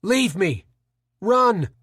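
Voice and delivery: rising shouted order; ringing orders. Acted. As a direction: order them away to save them. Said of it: "Carl's orders rang through the stairwell".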